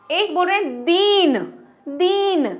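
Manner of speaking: दीन with a long stretched ई. Shown as a demonstration. Teacher contrasts दीन with दिन for students. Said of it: "दीन मतलब तो गरीब".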